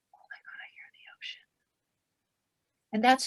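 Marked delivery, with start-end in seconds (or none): 0.1-1.5 hushed, breath-only wonder; awed whisper. Acted. Listener with a seashell to the ear, awed whisper. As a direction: whisper it as a secret discovery. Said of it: "put it to our ear and go".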